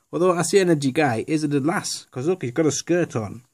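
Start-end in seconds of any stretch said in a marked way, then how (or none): none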